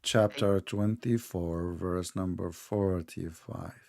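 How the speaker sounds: very slow, low and soft; deliberately slow. Shown as a demonstration. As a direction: spell it out slowly for an impatient listener. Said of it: "Okay, I will speak slow".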